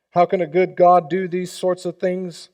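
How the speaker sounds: ordinary talk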